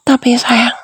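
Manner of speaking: whispered taunt. Acted.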